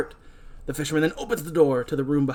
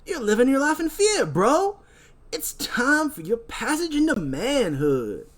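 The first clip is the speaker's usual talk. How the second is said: high, slow stoner-bro voice; hammed-up party dude; 8 semitones higher, slower than usual.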